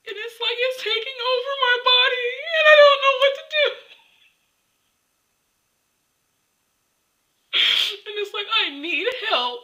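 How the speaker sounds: breaking into loud, high sobbing words; real crying. Real, not acted. Telling viewers she needs help, crying. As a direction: cry out helplessly. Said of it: "Okay, I'm gonna cry".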